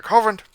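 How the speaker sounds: very high, loud yelp of the name; Hovind yell. Shown as a demonstration. Mocking Hovind with a squealed shout of his name. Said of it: "do the Hovind voice".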